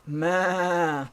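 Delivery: long, high "maa" bleats; merry bleating. Acted. Pig goes along his way, merrily bleating. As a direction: bleat merrily like a piglet playing goat. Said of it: "Pig merrily went along his way".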